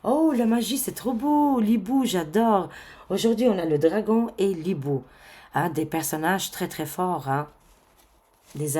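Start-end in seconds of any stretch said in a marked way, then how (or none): 0.0-5.0 delighted. Real, not acted. Marvelling at the oracle cards.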